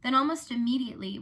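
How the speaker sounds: plain reading voice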